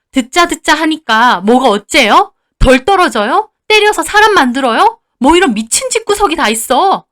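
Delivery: high, loud outraged questions; furious yelling. Acted. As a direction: throw their insults back at them. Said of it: "언니도 막 고래고래 소리를 질렀습니다". (고래고래 소리치며 따지는 언니)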